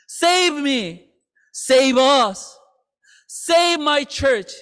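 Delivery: ordinary talk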